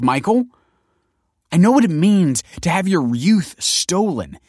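high, loud, desperate outburst; impassioned protest. A narrator's reading. Kelsey insists she spared him a hard life, impassioned. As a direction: justify yourself at full pitch. Kelsey's heated, tearful self-defence.

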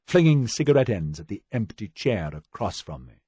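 ordinary delivery

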